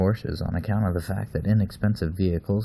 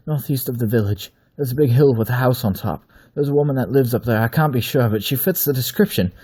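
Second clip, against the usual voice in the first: rapid pace; 5 semitones higher, 7 dB louder than usual. Acted. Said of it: "he said in a rapid pace".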